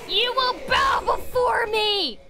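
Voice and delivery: high, slow, grandiose shout; hammed-up imperious yell; mock tyrant. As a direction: proclaim it like a power-mad conqueror. Mock tyrant battle cry.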